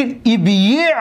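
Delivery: ابیع slow as ib-yi', b closing the syllable; heavy consonant cluster. Shown as a demonstration. Teacher demonstrating a hard-to-say form, heavy and split. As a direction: stop on the b in ابیع before the y. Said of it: "ثقیل است".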